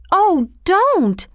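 high-pitched children's plea in a put-on voice; pleading. A narrator's reading. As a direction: cry out imploringly, pitch jumping well up. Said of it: "all the children pleaded at once".

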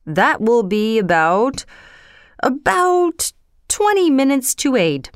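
hesitant, stretched-out reckoning; working it out. A narrator's reading. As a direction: stall on "about" while working out the time. King works out the time.

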